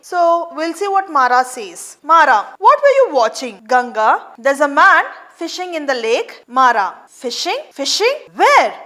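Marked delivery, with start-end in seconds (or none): 2.1-6.3 fast, eager exchange; fast dialogue. Acted. 6.6-8.7 excited question. Acted. Father excited about fishing.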